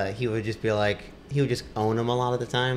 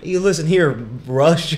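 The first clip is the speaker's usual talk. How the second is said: loud, higher hammed-up telling-off; mock-angry caller; 6 semitones higher, 9 dB louder than usual. Joining in as irate caller to radio host, mock anger. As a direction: play at scolding the host.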